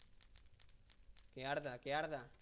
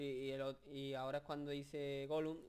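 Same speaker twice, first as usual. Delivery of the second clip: usual speaking voice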